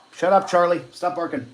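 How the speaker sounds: calling off the barking dog, loud; scolding the dog. Real, not acted.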